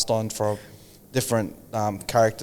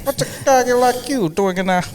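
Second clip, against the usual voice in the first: put-on high old Hollywood tough guy; old-movie gangster voice; 13 semitones higher than usual. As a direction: deliver it like a noir film. 1940s noir pickup line.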